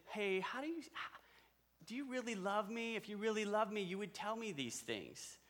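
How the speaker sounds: a hint of a wheedling Delilah, half-mocking; mock pleading Delilah. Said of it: "you come to me and say".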